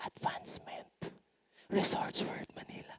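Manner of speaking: soft unvoiced whisper of the plug words; hushed whisper, no voice at all. Shown as a demonstration. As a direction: breathe the words like a secret murmur. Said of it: "Bubulong lang".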